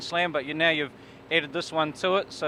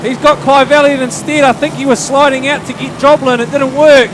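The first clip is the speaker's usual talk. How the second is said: higher, louder excited finish call; motorsport commentator; 6 semitones higher, 9 dB louder than usual. Acted. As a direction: bring the race home to the flag. Calls the race finish.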